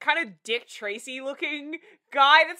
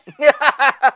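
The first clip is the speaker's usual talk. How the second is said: loud laughing fit; 2 semitones higher, 13 dB louder than usual. Real, not acted. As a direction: crack up out loud.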